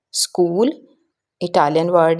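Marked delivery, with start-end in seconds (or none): none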